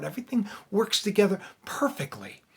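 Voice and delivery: hushed, unvoiced whisper of the truth. Real, not acted. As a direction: lean in and whisper it like a secret. Whispered echo of sermon line.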